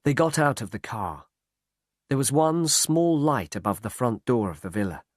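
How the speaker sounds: ordinary delivery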